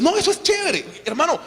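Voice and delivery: high-pitched mock enthusiasm; acted enthusiastic churchgoer. Member telling a brother that church is cool, mock enthusiasm. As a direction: pitch it right up, like a member raving.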